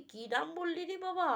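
high incredulous question; astonished father. Acted. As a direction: gasp out the question in disbelief. Father stunned by names. (হতবাক বাবার প্রশ্ন)

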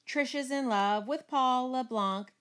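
put-on high teasing voice. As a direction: tease your sister about her crush. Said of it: "made kissing sounds and said".

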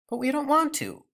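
put-on high girl's protest; whiny protest. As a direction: whine like a child. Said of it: "protested Gretel".

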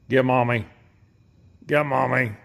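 loud urging. Real, not acted. Urging coyote to play.

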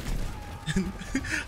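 nervous laugh after a scare; startled laugh. Real, not acted. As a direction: laugh off the fright. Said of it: "you scared me".

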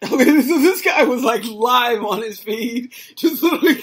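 laughing. Real, not acted. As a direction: laugh it off before carrying on. Laughing at the played clip.

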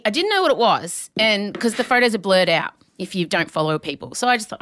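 ordinary talk

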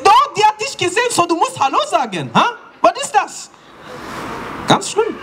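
put-on very high, indignant accented mum; mother's voice. Mum insists the son must greet auntie. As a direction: insist hard, outraged.

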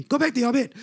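high, disbelieving telling-off; scolding nurse. Retold or imitated. Nurse disbelieving patient's healing, high scolding.

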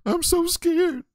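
high squeaky mock fright; high mock-frightened squeak; mock scared kid. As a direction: squeal it like a cowering camper. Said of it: "exactly what these kids sound like".

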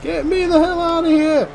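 high-pitched, urgent holler; urgent cry. Real, not acted. Player fleeing a fight, urgent high cry.

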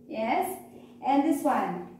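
usual speaking voice

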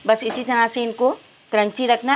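normal talking voice

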